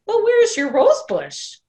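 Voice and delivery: looking around and seeing nothing; puzzled surprise. Acted. Missus Giamatti asking Wanda where the bush is: high, puzzled.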